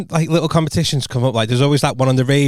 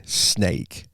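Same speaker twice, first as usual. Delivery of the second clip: a breathy whisper under the breath; whispered thought; 6 semitones lower, 7 dB quieter than usual. Retold or imitated. Whispering a private thought about a sneaky friend. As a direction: hiss it to yourself.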